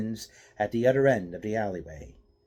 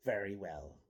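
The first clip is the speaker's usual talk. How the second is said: calm and quiet; 11 dB quieter than usual. Acted. Stranger calmly accepts the fight.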